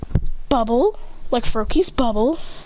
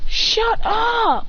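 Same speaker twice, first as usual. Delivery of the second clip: high sharp shout; yelling at the dog; 11 semitones higher than usual. Real, not acted. Kid snapping at his barking dog, high shout.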